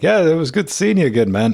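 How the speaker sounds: loud, high-pitched chummy goodbye; mock friendly farewell. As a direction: fake knowing them with hearty warmth. Acting out faked friendly recognition.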